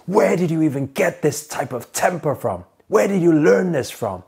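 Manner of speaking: mock the furious father demanding answers; father yelling at his teenage son; dad's angry retort. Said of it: "and then he says".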